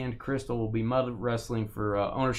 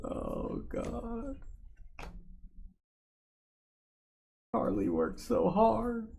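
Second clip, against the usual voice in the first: high, soft, fake-tearful voice; mock crying; 9 semitones higher, 6 dB quieter, much slower than usual. Teasing Carly about her yard work, mock weepy. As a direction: pretend to choke up, hammed-up and quiet. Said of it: "Sorry, I get emotional sometimes".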